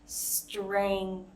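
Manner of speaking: strength spoken slowly, every sound through; walk through each sound of strength; careful slow strength. Shown as a demonstration. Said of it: "we can slow it down".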